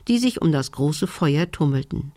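ordinary delivery

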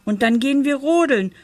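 put-on high, eager boy building to jubilant whoops; excited cheering. A narrator's reading. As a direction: gush the plan, then whoop for joy. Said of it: "rief Pitt". (begeistert, hoch, am Ende jubelnd)

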